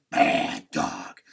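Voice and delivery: loud stern "Bad dog"; stern dog scold; sharp scolding. Shown as a demonstration. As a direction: snap a reprimand at the dog. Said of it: "if you change your voice".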